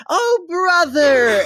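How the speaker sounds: very high, loud, drawn-out squeal; delighted cry. Acted. Devotees recognising one of their own, delighted squeal. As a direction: cry out in sudden delighted welcome. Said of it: "washes away into these bright smiles".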